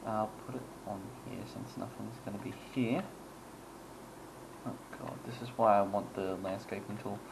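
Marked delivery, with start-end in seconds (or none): none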